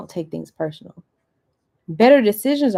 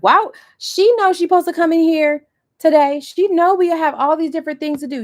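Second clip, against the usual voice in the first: higher, louder, offended huff; indignant boss; 9 semitones higher, 8 dB louder than usual. Retold or imitated. Boss fuming about an employee's bad day, mock indignant. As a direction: sound personally put out by the late employee.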